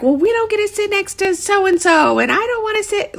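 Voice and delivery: high-pitched mock children's whine; whiny kids. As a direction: imitate kids complaining about seating. Kids squabbling over seats.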